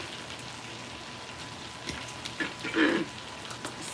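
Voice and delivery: choking cough after swallowing wrong; throat clearing. Real, not acted. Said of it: "I swallowed the wrong way".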